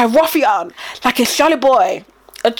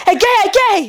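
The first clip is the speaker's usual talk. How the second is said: mock shocked parent; 8 semitones higher than usual. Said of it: "You'll be like".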